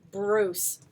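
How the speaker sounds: ordinary delivery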